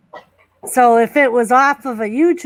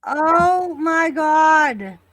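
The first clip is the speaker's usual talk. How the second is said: stunned; 5 semitones higher, much slower than usual. Real, not acted. Stunned by the match.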